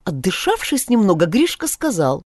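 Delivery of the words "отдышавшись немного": ordinary delivery, nothing marked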